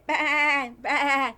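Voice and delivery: loud bleating baa like a sheep; a teacher doing a loud sheep baa for the class. Retold or imitated. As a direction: bleat it for the children. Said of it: "and he said".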